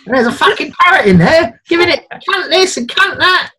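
high, loud scandalised yell; bursting to tell shocking news; excited shouting gossip. Retold or imitated. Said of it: "Someone at home have gone".

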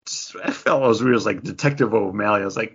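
usual speaking voice

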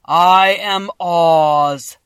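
a vast, slow, thundering wizard; voice of the great Oz. A narrator's reading. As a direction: boom each word out like a huge magic head. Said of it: "Dorothy heard a voice say".

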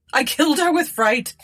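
high-pitched frantic wail; screamed confession. A narrator's reading. Miserable woman confesses and sees a ghost, screaming. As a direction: pour out guilt, then shriek in terror. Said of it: "screamed the miserable woman".

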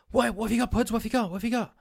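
high, urgent repeated questioning; mimicked alarm. Husband imitating wife as the dog lunges, high-pitched alarm.

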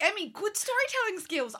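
high squeaky voice. Retold or imitated. Imitating her inner praise.

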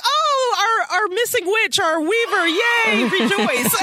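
high, gushing mock celebration; mock rejoicing. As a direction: ham up the coven's joy. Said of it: "they're all like".

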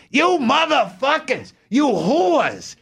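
put-on high, shrieking curses from an old woman; high, slow shrieked rant in a little old lady's voice; old lady screaming. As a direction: scream like a furious old lady alone at home. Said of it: "behind closed doors".

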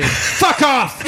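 high, cheerful swear; high, cheerful mock shout of Fuck off; happy shout. Shown as a demonstration. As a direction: yell it with a grin.

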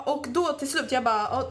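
normal talking voice

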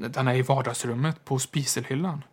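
ordinary delivery

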